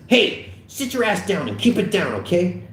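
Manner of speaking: high-pitched irritated order; angered officer. A narrator's reading. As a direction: order him down crossly. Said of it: "Michaels looks at the angered Slater".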